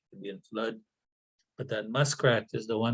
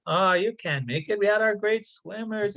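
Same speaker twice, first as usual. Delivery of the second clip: teasing; 7 semitones higher than usual. Acted. Animals teasing the muskrat.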